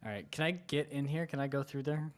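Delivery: ordinary talk, nothing marked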